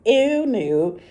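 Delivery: playful disgust; mock disgust. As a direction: recoil at the thought. Disgust at no oysters.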